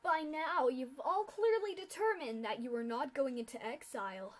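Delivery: loud, gloating official's speech; loud, clear announcement. Acted. Official pegasus on the podium addresses the captive foals, loud gloating speech. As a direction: proclaim it from the podium with cruel relish. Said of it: "started speaking loudly and clearly".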